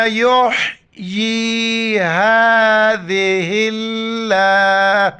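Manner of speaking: slow, drawn-out melodic recitation of the verse; Quran recitation. Acted. Teacher reciting the verse to students, slow and melodic. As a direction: chant each word long and even, like tilawat.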